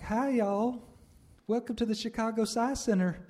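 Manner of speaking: loud, high-pitched Southern woman's greeting; deep Southern accent. Retold or imitated. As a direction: call out a welcome in a broad Southern accent. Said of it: "like a deep Southern accent".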